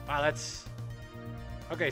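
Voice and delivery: ordinary delivery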